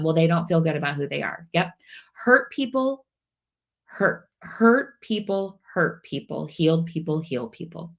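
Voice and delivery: ordinary talk, nothing marked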